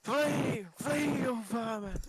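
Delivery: high, loud taunting shout; battle shout. Acted. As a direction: shout the rats away in character. Player shouts at fleeing rats.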